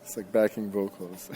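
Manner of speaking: ordinary talk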